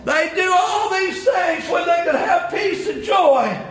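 high-pitched, driving pulpit shout; full-force preaching. Real, not acted. Preacher urging folks it's time to serve God, impassioned shouting. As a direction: exhort the congregation at full cry.

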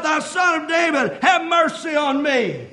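high preacher's cry for mercy. Retold or imitated. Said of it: "he cried out".

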